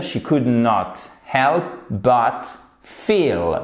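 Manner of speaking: slow, deliberate English; slow model phrase. Shown as a demonstration. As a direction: lay each word down for learners. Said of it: "Vous mettez juste la base verbale". (phrase anglaise lente, mot par mot)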